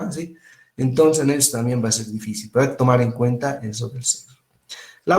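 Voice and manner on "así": soft-voiced man. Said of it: "varones que tienen voz bien suavecita".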